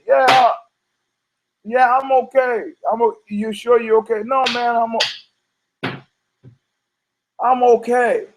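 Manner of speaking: high, shaken insistence; still freaking out. Retold or imitated. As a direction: insist you're fine, pitched high and rattled. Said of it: "the guy's still freaking out".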